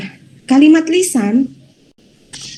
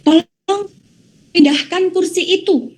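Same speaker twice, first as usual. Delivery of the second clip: higher pitch on "Tolong", pressing the request; emphatic command; 6 semitones higher than usual. Shown as a demonstration. Teacher showing students an exclamation sentence, emphatic higher command. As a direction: demand it, leaning hard on "Tolong". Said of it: "kita ada penekanan di situ".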